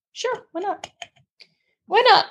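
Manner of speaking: high, careless shrug of a reply; breezy sarcasm. Retold or imitated. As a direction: toss it off, mock casual. Offered the doctor's letter.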